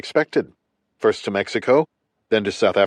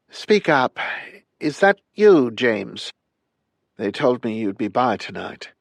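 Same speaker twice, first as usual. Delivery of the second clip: higher, thin-voiced elderly lady; frail old woman; 4 semitones higher than usual. Frail dying old woman speaks.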